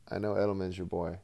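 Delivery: ordinary talk, nothing marked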